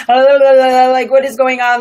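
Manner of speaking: loud, high mock-panic babble; babble, then blurt it in a flap; freak-out mode. Getting a bill in the mail, mock freak-out. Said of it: "freak out mode".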